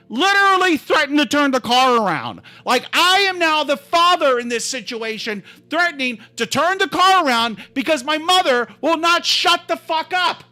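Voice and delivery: heated, high, rising exasperation; getting angry. Real, not acted. Speaker relives threatening to turn the car around, real anger. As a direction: get worked up reliving it, pitch climbing. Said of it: "I'm getting angry just reliving this".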